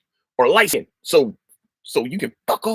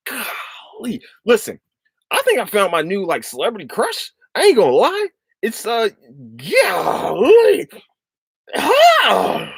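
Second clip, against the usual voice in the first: high-pitched, swooning fan talk; giddy crush; 6 semitones higher than usual. Real, not acted. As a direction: squeal about your new crush. Confessing a new celebrity crush.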